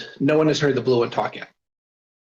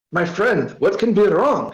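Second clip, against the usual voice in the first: put-on higher-pitched, thick Russian-style accent; 6 semitones higher, 4 dB louder than usual. A narrator's reading. Hammer asking his friend what is wrong, thick Russian accent. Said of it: "Hammer asked in his thick accent".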